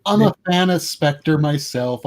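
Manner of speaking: ordinary talk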